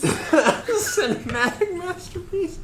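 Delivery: high squeal, slowed by laughter; laughing. Real, not acted. Friend echoes the verdict on a video, helplessly laughing. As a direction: crack up through the words, very high.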